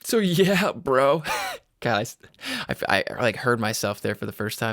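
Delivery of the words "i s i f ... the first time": normal talking voice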